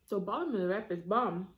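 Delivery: talking with food in mouth; muffled chewy speech; chewing while talking. Real, not acted. Seen 1: Bit wrap at -12; jaw working, lips closed through early words.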